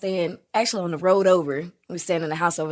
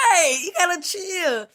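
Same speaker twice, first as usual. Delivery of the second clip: high teasing scold; 9 semitones higher than usual. Retold or imitated. Telling niece to chill.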